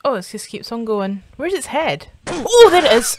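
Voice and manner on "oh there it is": loud, high burst of fright; startled cry. Real, not acted. Viewer spots the snake's head, startled high cry. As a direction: yelp it out on sighting the thing.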